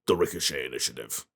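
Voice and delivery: low, rough gravel voice; gravelly voice. Shown as a demonstration. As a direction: grind the words out low and rough. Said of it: "saying it in a gravelly voice".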